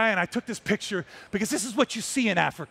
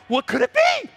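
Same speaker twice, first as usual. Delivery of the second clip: excited; 12 semitones higher than usual. Retold or imitated. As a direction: yell it out in wonder. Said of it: "I go".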